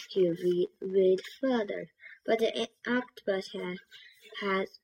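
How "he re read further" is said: ordinary delivery, nothing marked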